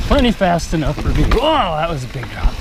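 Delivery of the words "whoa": high startled whoa; surprised whoa. Real, not acted. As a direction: gasp at the drop. Big drop on descent.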